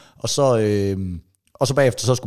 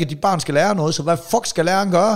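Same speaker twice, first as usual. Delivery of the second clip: ordinary talk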